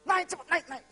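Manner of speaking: loud, high, repeated command; angry father. Retold or imitated. Father hurrying his son upstairs, angry shout. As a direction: shout him up the stairs.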